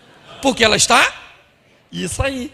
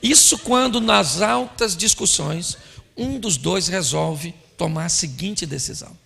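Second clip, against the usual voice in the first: ordinary talk, nothing marked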